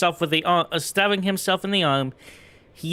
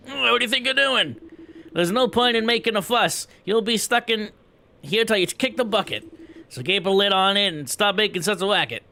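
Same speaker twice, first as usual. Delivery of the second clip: higher, sharp telling-off; scolding guard; 4 semitones higher than usual. Acted. Guard telling the new prisoners to stop the racket, scolding. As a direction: bark down at the noisy prisoners.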